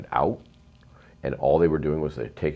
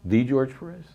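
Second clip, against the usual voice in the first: surprised; 9 semitones higher than usual. Retold or imitated. Retold amazement at famous name.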